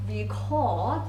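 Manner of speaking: record as ree-CORD, second syllable stressed; verb stress. Shown as a demonstration. Teacher showing the verb record, stressed on the end. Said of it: "it's a verb".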